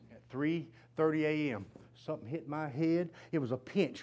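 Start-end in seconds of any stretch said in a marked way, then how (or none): none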